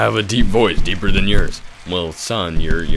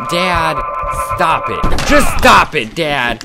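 exasperated son; 5 semitones higher, much slower than usual. Acted. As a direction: build to a fed-up protest at Dad. Son fed up with his dad.

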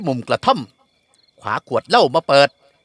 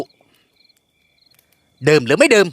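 gruff demand; 4 semitones higher, much slower than usual. A narrator's reading. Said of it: "ถามเสียงดุๆ".

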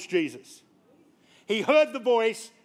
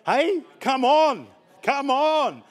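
higher and louder, slowed, rousing; excited call out; 4 semitones higher, 4 dB louder, much slower than usual. Real, not acted. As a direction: stretch it out to hype the room. Pastor hyping up the congregation.

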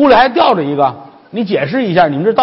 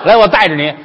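usual speaking voice

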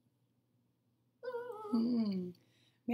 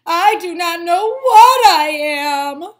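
slow, loud, odd voice; lost little weed; 4 semitones higher, 15 dB louder, much slower than usual. Acted. Worried Walt crying out his confusion. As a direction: wail it slowly.

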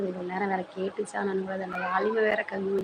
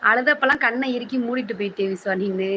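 loud chiding; 2 semitones higher, 9 dB louder than usual. Real, not acted. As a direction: call out a loud complaint to your son. Mother chides son loudly.

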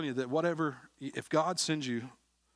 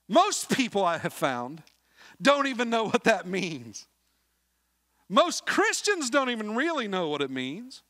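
full-force preaching; 10 semitones higher, 6 dB louder than usual. Acted. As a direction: drive the point home from the pulpit.

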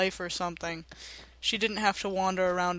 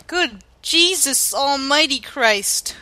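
awed exclamation; 5 semitones higher, 9 dB louder, slower than usual. Real, not acted. Awe at landscape.

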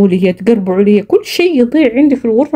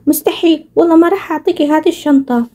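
put-on higher bratty kid voice; squabbling child; 5 semitones higher than usual. Sisters fight over the same bag, higher and stubborn.

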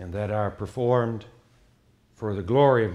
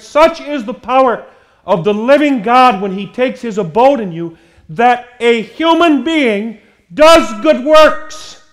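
loud, rising preaching with a raised pitch; forceful preaching; 9 semitones higher, 9 dB louder than usual. Acted. Preacher rousing his congregation, forceful preaching. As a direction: drive it home to the congregation.

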